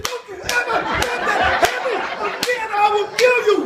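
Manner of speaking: high, laughing mock threat; mock angry mother. Mother threatening son after his fall, mock angry. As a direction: scold like a relieved, furious mom. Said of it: "She said".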